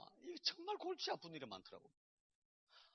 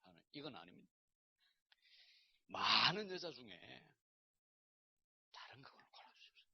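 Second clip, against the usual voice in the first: whispered plea to God; 5 semitones lower, 5 dB quieter than usual. Retold or imitated. Refusing God's answer.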